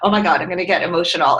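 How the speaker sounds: ordinary talk, nothing marked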